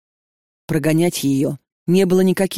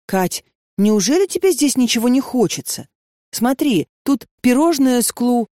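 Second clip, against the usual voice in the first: put-on a light, higher chatty girl; lightly voiced bubbly friend; chirpy girl voice; 7 semitones higher than usual. A narrator's reading. Lena chatters.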